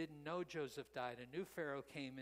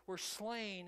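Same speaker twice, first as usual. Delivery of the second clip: ordinary talk, nothing marked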